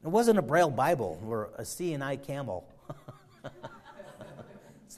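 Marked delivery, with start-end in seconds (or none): 2.9-5.0 breathy laugh through the line, slow. Shown as a demonstration. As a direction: chuckle the words out slowly.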